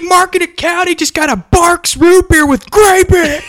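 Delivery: high, loud, excited announcer; mock announcement. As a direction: shout it out to the office.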